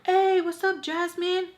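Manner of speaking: high, loud flirty hello; mock eager guy greeting.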